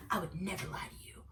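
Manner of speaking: intense whispered promise; imitating his breathy, hushed sincerity; hushed vow. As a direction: whisper it quickly, as a solemn reassurance. Retold earnest vow at close range.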